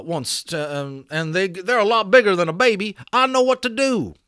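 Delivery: high, loud cowboy voice, urgent; a cowboy begging to be let in to help; Boone pleading. Acted. Boone pleads at teepee flap.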